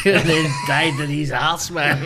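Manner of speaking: usual speaking voice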